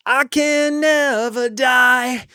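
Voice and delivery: slow high shouted rock-star chant. Shown as a demonstration. Frontman shouting to the crowd from the riser, slow high shout. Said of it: "[name] on stage".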